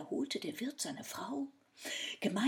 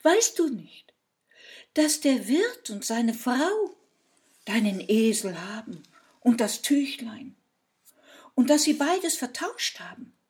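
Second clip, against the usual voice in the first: loud, raised-pitch reproach; scolding fairy; 3 semitones higher, 12 dB louder than usual. A narrator's reading. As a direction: spell it out in exasperation. Fairy scolds the foolish man. (laut und vorwurfsvoll)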